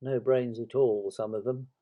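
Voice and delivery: ordinary delivery, nothing marked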